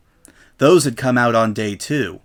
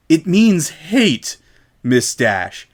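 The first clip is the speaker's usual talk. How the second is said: slow, strained, barely held in; shop owner's stiff menace; 4 semitones higher, 3 dB louder, much slower than usual. A narrator's reading. Shop owner to Rainbow, slow restrained anger. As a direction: grind each word out slowly. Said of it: "words themselves were being worried between her teeth".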